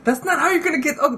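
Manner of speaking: high, laughing disbelief, slowing on the last words; incredulous laugh. Retold or imitated. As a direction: laugh through the outrage. Jill incredulous about the reshoot.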